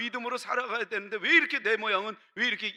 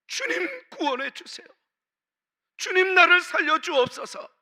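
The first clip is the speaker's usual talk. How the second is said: much higher and louder, pleading cry; crying out to the Lord; 8 semitones higher, 7 dB louder than usual. Retold or imitated. A sinking believer crying to the Lord, loud high plea. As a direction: call out to be rescued. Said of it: "외치는 그에게".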